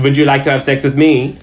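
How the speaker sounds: put-on French-accented come-on. Bartender Goo hitting on a party member, French accent. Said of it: "he spoke in a French accent".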